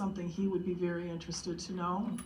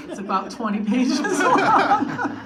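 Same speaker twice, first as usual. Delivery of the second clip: laughing; 8 semitones higher, 11 dB louder, slower than usual. Retold or imitated. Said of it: "And I started laughing".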